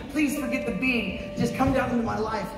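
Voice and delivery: high, exasperated mock plea; pleading skyward. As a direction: act out begging the sky for help. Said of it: "literally talking to the sky".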